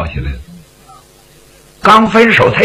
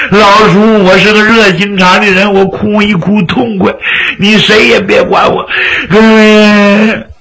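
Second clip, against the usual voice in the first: whining, crying protest held on a raised note; tearful wailing; 3 semitones higher than usual. Acted. Fang Shu'an refusing his uncle's comfort, crying on and on. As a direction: insist through hammed-up sobs, ending in a wail. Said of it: "他哭起来没完了".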